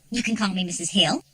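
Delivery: ordinary delivery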